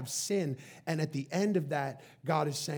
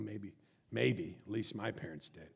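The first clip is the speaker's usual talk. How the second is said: ordinary delivery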